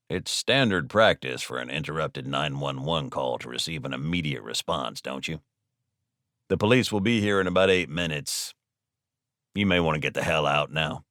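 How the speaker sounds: low, soft, unruffled delivery; quietly self-assured hostage; cool bluffing. Acted. Alden coolly bluffs the robbers.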